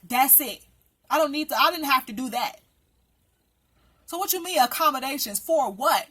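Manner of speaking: tearing into him, voice up and hot; angry rant. Real, not acted.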